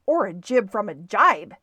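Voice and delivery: loud, eager outburst; crying out. A narrator's reading. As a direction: cry it out as you chime in. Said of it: "cried Stewart".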